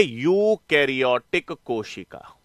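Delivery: low, drawn-out term, syllable by syllable; slow dictation. Real, not acted. Teacher answers own question while writing, slow dictation. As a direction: say it slowly, as if writing it down.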